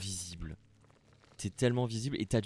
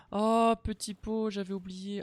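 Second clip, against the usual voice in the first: lightly cooing; 6 dB louder than usual. Acted. Player cooing at the little pot character. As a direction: coo tenderly at him.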